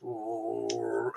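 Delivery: low, soft drawn-out "ooh" as a machine noise; imitated TARDIS noise. Fan retelling the TARDIS starting to make that noise, imitated low hum. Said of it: "the tardis starts making that noise".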